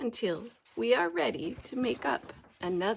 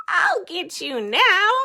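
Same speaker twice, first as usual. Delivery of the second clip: loud, higher bat threat; squealing bat; 8 semitones higher, 9 dB louder than usual. Acted. Bat threatens the pair, loud high squeal. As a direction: lunge at them with it. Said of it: "squeals Bad Egg".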